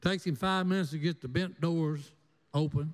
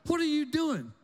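retold scream; 9 semitones higher, slower than usual. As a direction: shriek it like a shocked mother. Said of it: "And Mama screams".

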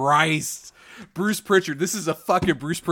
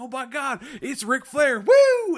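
higher and louder, building into a woo shout; mock fan cheer; 9 semitones higher, 5 dB louder than usual. Mocking fans cheering Ric Flair's return, hammed-up shout. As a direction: ham up a crowd popping for Flair. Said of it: "everybody's gonna be like".

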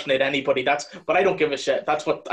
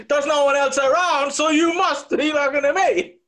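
much higher, louder hammed-up shout; mock screaming De Niro; 10 semitones higher, 3 dB louder than usual. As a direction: yell it at someone minding their own business. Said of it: "screaming a lot more".

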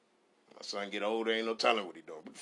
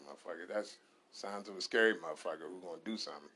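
ordinary talk, nothing marked

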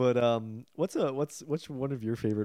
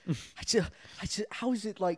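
lost for words, pitched up high; baffled high voice; 10 semitones higher than usual. Retold or imitated. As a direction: stammer it in a much higher, put-on voice. Himself baffled after the movie.

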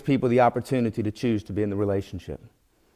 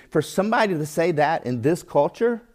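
normal talking voice

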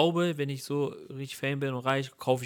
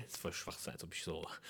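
whispered aside, much softer; muttered; 5 semitones lower, 16 dB quieter, faster than usual. Real, not acted. Whispering his objection while his friend pushes the jet idea. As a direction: mutter it under your breath.

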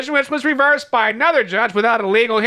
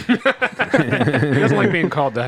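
laughing; 5 semitones lower, slower than usual. Real, not acted. Laughing at the name joke.

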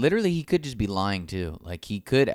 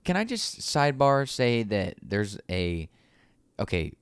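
ordinary talk

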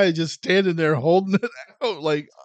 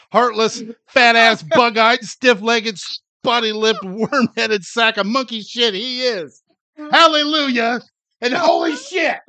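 building from brisk list to loud high tirade; animated Clark rant; 7 semitones higher, 4 dB louder than usual. Pretend. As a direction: unleash a growing furious rant. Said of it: "channel your inner me".

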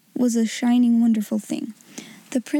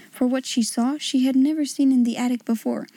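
ordinary delivery

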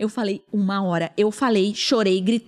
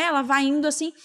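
ordinary delivery

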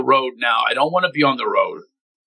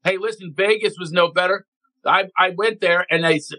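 ordinary talk

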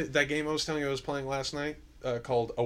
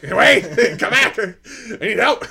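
slow, loud, high, laughing cry for help; laughing plea; 8 semitones higher, 13 dB louder than usual. Retold or imitated. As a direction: plead through laughter. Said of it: "And I'm like".

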